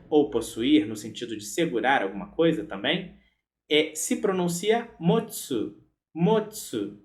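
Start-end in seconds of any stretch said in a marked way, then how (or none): none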